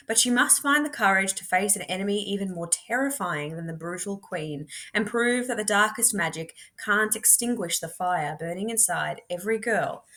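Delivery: usual speaking voice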